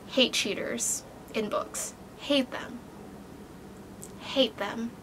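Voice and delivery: soft hissing whisper of disgust; whispered hatred. Real, not acted. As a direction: whisper it like a grudge. Venting hatred of cheaters.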